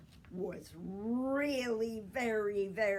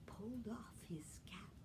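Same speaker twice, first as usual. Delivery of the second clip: whispered; 10 dB quieter than usual. A narrator's reading. Whispered suspense narration.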